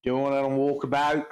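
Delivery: put-on Aussie accent; broad Australian vowels on walkabout. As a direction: echo it as a mock Australian. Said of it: "maybe just do the Australian".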